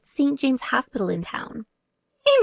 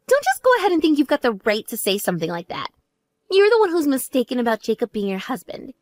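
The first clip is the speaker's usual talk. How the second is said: normal talking voice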